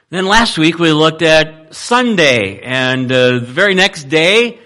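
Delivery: normal talking voice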